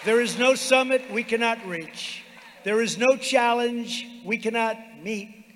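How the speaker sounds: building to a rousing shout; rally the crowd, loud and high; roaring rallying speech. Real, not acted.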